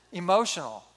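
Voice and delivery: ordinary talk, nothing marked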